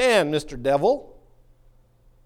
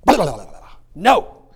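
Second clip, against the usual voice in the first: dismissive blah; 8 dB louder than usual. Shown as a demonstration. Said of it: "you gotta say".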